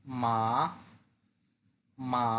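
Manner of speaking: মা dipping low then rising back up; Mandarin third tone. Shown as a demonstration. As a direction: break মা in the middle with a dip. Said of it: "সেই শব্দটা একটু ভেঙে বলতে হয়".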